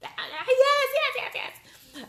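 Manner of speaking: very high, squealing yeses; ecstatic yes. Retold or imitated. Replying to the co-founder's offer, squealing yes. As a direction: accept with a giddy squeal. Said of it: "of course I responded back".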